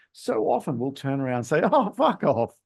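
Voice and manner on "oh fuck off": jokey fuck off. Shown as a demonstration. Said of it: "it was the tone of voice".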